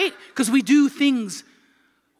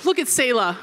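ordinary talk, nothing marked

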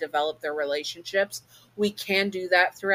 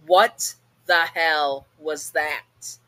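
slow, louder, put-on girl voice; Baggy's voice; 5 dB louder, much slower than usual. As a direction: drag it out, startled and rude. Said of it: "Baggy says".

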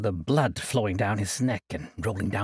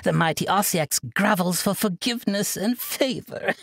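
laughing out the words; 7 semitones higher, 3 dB louder than usual. A narrator's reading. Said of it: "Ashmara laughed out the words".

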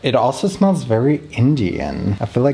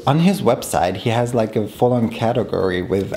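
ordinary talk

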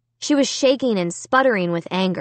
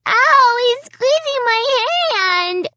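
put-on loud, very high child's yelp of pain; high-pitched pained wail; crying little girl; 3 dB louder, slower than usual. A narrator's reading. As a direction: squeal like a hurt small child. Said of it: "Alicia cried".

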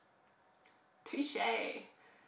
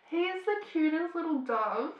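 higher, louder adoring gush; 6 semitones higher, 6 dB louder than usual. Real, not acted. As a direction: coo over something adorable. Gushing over a character.

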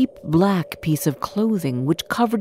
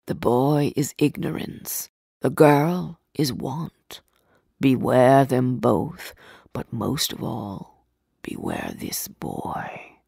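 low, slow ominous spirit; solemn spirit warning; 6 semitones lower, 3 dB quieter than usual. A narrator's reading. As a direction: sink lower and softer into a grave warning.

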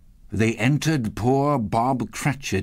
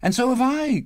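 put-on high, childlike chiming in; eager cry. A narrator's reading. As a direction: echo keenly in a high voice. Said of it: "cried another".

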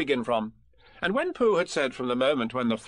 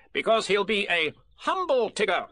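high, bossy little rabbit voice; fussy Rabbit; 5 semitones higher than usual. Acted. Rabbit pressing Piglet to agree, bossy and high. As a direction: lay out the plan like a busy organiser.

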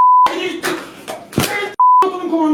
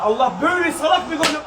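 ordinary talk

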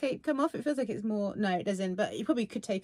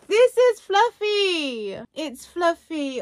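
high, loud, slow gushing over fluffiness; 6 semitones higher, 8 dB louder, much slower than usual. Real, not acted. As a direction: savour every word with delight. Touching the fluffy plush.

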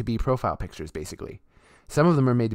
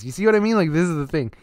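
suddenly louder and higher, fired up; heated outburst; 7 semitones higher, 8 dB louder, faster than usual. Real, not acted. Ranting to viewers about NFT scammers, a sudden loud, high outburst. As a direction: burst out, exasperated.

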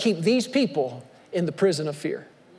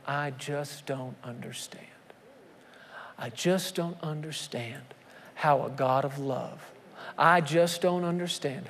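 soft bewildered lament; 4 semitones lower, 6 dB quieter than usual. Retold or imitated. Grieving believers questioning God after tragedy, softly bewildered. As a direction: murmur it in helpless confusion. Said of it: "people start going".

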